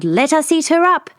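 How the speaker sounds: mother bear growl. Acted. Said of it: "Mother Bear growled".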